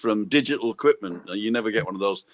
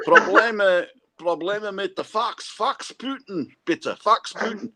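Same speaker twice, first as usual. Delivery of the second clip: higher, slow mock German with a Scottish accent; 8 semitones higher, slower than usual. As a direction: imitate a Scottish lad speaking German-Scottish, slowly. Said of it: "like he was talking German with a Scottish accent".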